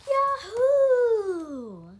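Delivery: huge high shout of glee; joyful whoop. Pretend. Kid imagines flying loops in heaven, whooping. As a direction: whoop like riding a rollercoaster.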